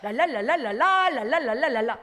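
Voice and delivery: 랄랄라 and 렐렐레 flicked fast with the tongue alone; just flap the tongue, lips still; tongue-only babble. Shown as a demonstration. Showing what tongues sound like when only the tongue moves. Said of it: "그렇게 하는데".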